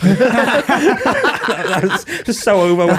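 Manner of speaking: loud, slow, through laughter; laughing. Real, not acted. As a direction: laugh the words out slowly. Laughing at his own story.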